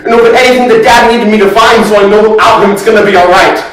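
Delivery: high, worked-up, loud outpouring; agitated and insistent, pitched high; frantic recounting. Acted. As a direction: reel off the list, growing desperate. Acted frantic list of failed searches.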